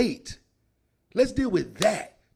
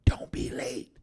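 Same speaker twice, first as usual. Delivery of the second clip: whisper; slower than usual. Shown as a demonstration. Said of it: "repeated as whisper".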